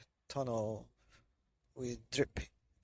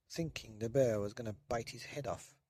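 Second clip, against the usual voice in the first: plain reading voice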